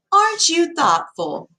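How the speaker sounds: high, slow sing-song gushing; chiming town lady. A narrator's reading. Second town lady gushing over the girl, high sing-song. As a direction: chime it out like a prim church lady. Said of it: "chimed another".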